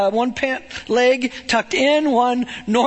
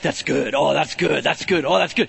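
God pleased; 9 semitones lower than usual. Retold or imitated. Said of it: "he was like".

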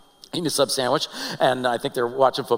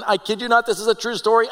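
ordinary talk, nothing marked

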